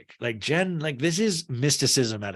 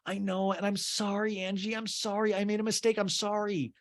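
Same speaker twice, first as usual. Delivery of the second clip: high, pleading mock apology; switching tactics; 9 semitones higher, 3 dB quieter than usual. [name] apologizing to Angie, high and pleading. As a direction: pitch it up like a desperate plea. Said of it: "this is called switching tactics in acting".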